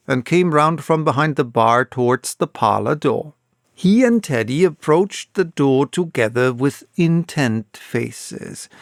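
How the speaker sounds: ordinary delivery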